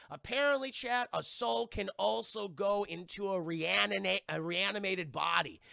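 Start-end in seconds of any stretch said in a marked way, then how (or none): none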